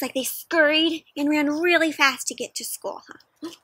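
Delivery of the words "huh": ordinary delivery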